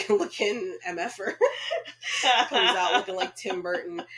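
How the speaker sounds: real laughter breaking through the words; laughing. Real, not acted. Podcaster mocking a character's Tim Burton look, laughing. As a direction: crack up midway through the insult.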